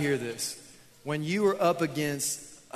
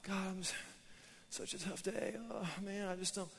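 mock whimpering prayer, much softer; complaining; 3 semitones higher, 13 dB quieter than usual. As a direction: whine it weakly to God, barely finishing. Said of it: "Why do we complain our prayers".

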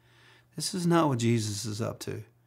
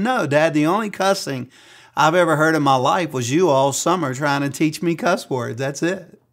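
son's answer; 4 semitones higher, 7 dB louder than usual. Retold or imitated. Son Hunter answering dad.

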